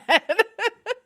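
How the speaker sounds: short, high burst of laughter. Real, not acted. Laugh after story.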